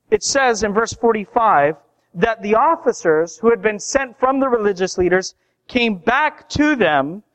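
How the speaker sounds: normal talking voice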